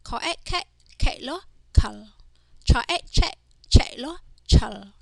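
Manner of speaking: teacher's sing-song spell-and-read drill. Shown as a demonstration. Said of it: "let's practice reading these words".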